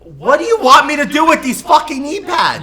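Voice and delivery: loud, high exasperated shout; outraged yell. Real, not acted. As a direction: yell it in disbelief. Outraged at knee pad gift.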